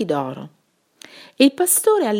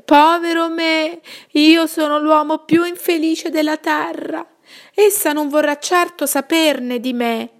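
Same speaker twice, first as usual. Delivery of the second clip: loud lament; 9 semitones higher, 4 dB louder than usual. A narrator's reading. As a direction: wail it out to the whole world. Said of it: "cominciò a lamentarsi ad alta voce". (lamento forte e acuto)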